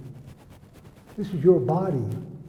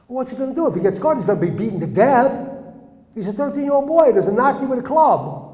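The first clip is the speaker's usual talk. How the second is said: raised, loud, urgent storytelling; alarmed; 6 semitones higher, 5 dB louder than usual. Real, not acted. Telling listeners of the boy facing a beating, alarmed. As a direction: press the danger on the listeners, voice up. Teller's alarm at boy's danger.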